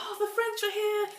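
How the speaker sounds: high, frantic cries for help; panicked screaming. Acted. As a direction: shriek for rescue in a hammed-up panic. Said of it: "they're screaming to get out".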